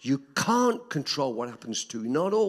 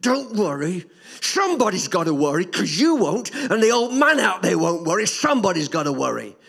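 louder, higher retort of a furious mother; angry mum; 5 semitones higher, 8 dB louder than usual. Retold or imitated. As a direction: snap back at the child like his worn-out mother. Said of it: "She looked at me in such anger".

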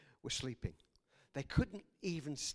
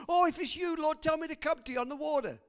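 loud, high, rushed shout; excited calling out; 10 semitones higher, 8 dB louder, faster than usual. Acted. Peter calls to Jesus walking on water, excited shout. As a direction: blurt it out eagerly across the waves.

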